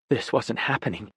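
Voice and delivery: ordinary delivery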